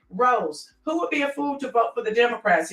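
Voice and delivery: ordinary talk, nothing marked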